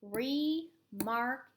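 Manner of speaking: re and mark split apart; pounded-out syllables. Shown as a demonstration. Teacher pounding out word parts, syllable by syllable. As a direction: beat out re, then mark. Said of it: "let's pound this one out".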